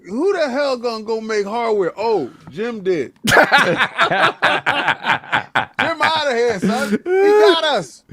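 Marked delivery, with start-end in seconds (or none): 2.0-6.9 higher, loud, laughing through words. Real, not acted. Gloating with laughter. 6.9-8.0 much higher, louder, slow cry-laugh; laughing shout. Real, not acted.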